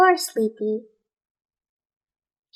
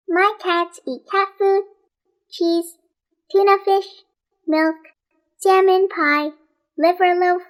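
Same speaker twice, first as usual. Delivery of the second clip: higher, quick chattering list; Rosamond voiced; 5 semitones higher than usual. A narrator's reading. Rosamond listing foods to Nate, high and chattering. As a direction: rattle off the foods in a high eager voice.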